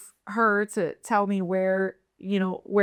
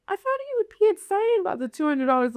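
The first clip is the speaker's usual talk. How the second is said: hurt pleading; 10 semitones higher, faster than usual. Retold or imitated. Pleading with the violent pimp.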